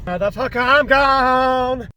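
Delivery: loud, panicked bail-out cry; frightened yell. Real, not acted. Scared by the ghost photos, a loud panicked yell. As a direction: yell it like you're fleeing the room.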